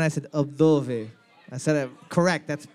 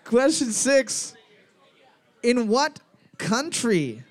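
slow, higher, drawn-out quiz announcement; grand announcer; 5 semitones higher, slower than usual. Acted. Quiz host building suspense for his co-host, slow and grand. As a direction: savour each word like a TV host.

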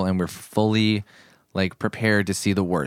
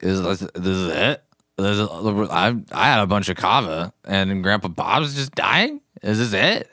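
put-on drunk voice. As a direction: play it a bit drunk and dazed. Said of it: "That's your drunk voice?".

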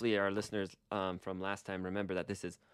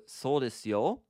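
So desu yo with a louder rising yo; assertive; 5 semitones higher, 5 dB louder than usual. Shown as a demonstration. Teacher showing a firmer answer, stressing yo. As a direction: press the yo to insist. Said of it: "a little bit more of a stronger, informative".

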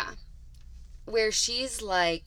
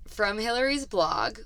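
usual speaking voice

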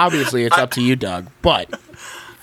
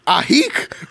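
mock shriek; 9 semitones higher than usual. A narrator's reading. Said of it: "the woman screams louder than you".

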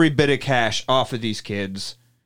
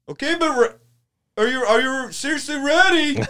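high teasing voice; hammed-up bar owner; mock taunting host; 11 semitones higher than usual. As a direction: dangle it over them gleefully.

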